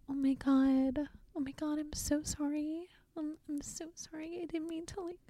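high, breathy, faltering mock apology; mock frantic apology. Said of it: "And it's the".

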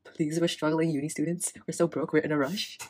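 lightly imitated pleading; mock begging. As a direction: beg like a broke student on the phone. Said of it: "she literally begged".

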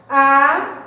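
"a" held alone as a long rising syllable; separated syllable. Shown as a demonstration. Teacher showing pupils the split, "a" said long and separate. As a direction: set "a" apart, stretched and lifted. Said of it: "ó, separa".

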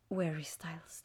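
breathless reverent whisper. Real, not acted. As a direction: whisper the name like a secret. Naming the concert star.

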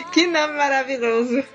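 laughing while saying it. Real, not acted. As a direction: crack up at the name. Laughing at name.

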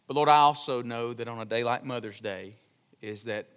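soft, low, reverent pastoral prayer; hushed congregational prayer. Real, not acted.

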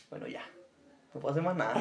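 quiet resigned take. Acted. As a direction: let it drop, giving up.

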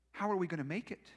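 normal talking voice

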